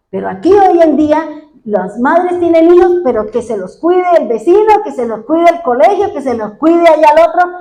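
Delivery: loud, high, insistent pulpit questions; preacher pressing the congregation with why after why; fervent sermon climax; preaching at full force. Acted. Preacher scolds mothers.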